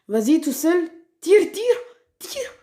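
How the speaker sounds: high, loud urging at the screen; shouting at the game in excitement; excited yelling. Real, not acted. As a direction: egg the character on, shouting. Urging on his brawler mid-fight.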